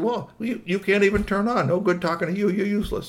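high-pitched, dismissive toy; scornful Squeaky Toy. Acted. Toy giving up on the robot boss, scornful.

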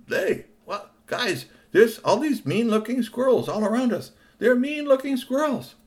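Tony warning friends about squirrels, high and anxious; Tony's alarmed voice. Acted. Tony voiced in bedtime story.

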